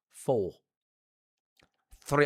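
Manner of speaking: normal talking voice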